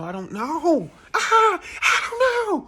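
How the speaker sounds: rising into a high, drawn-out wail; mock panic. Pressed about cross streets, breaking into a laughing mock wail. Acted panic at being questioned.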